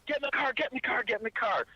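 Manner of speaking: high, insistent repeated order; a wife hurrying her family into the car; imitating a frantic wife; urgent wife. Wife urging him into car.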